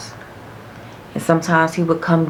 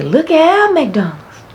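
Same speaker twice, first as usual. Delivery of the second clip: high, louder, slow mocking dad; dad teasing; 12 semitones higher, 7 dB louder, slower than usual. Dad teasing daughter about McDonald's, high slow mock wonder.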